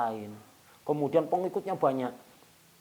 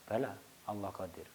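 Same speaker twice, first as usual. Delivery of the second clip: much softer, low-key answer; humble quiet reply; 5 semitones lower, 11 dB quieter than usual. Retold or imitated. (jawaban pelan dan rendah hati)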